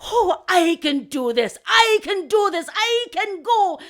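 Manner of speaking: slow, loud, rising eagerness; eager confidence. Acted. Last crow readying for his flight, excited.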